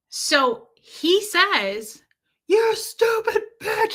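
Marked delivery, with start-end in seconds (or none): 2.5-3.9 high, strained mock scream; imitated screaming. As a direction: mimic a screaming man without full volume. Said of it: "He's screaming still".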